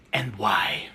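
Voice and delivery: breathy mock-menacing whisper; whispered question. Interrogator whispering at Ben to shame him.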